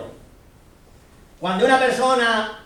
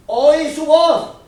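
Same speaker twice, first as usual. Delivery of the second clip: slow proclamation; 6 semitones higher, 7 dB louder, much slower than usual. Acted. Preacher proclaiming Isaiah's call.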